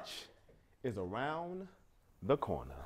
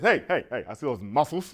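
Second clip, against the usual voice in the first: mock guy; 7 semitones lower, 4 dB quieter than usual. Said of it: "a guy came to me and did this".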